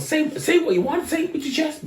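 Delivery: high-pitched mock woman's voice; high, daring retort; imitated challenging woman. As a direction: goad him in a put-on high voice. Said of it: "the woman goes".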